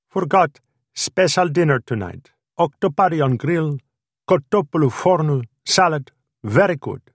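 high, eager Greek waiter; Greek accent. A narrator's reading. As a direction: rattle off the specials in a Greek accent. Greek waiter announces the special.

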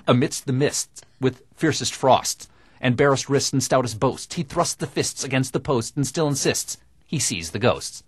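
crisp, over-enunciated tongue twister; diction exercise. Shown as a demonstration. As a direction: bite every consonant like a drama student. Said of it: "an acting exercise for diction".

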